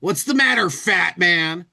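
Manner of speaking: mock hero taunting the fat villain; insulting hero. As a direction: sneer the insult at him like a cocky hero.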